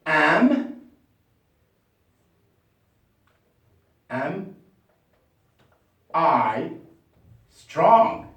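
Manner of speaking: Am, am, I, strong with long pauses; dictation. Shown as a demonstration. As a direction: pace it word by word for writing. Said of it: "let's just write it".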